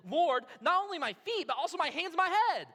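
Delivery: high, loud, eager plea; overeager swing. Acted. Simon swinging round to Jesus, loud overeager plea. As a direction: burst out, overdoing the eagerness. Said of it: "makes a pendulum swing".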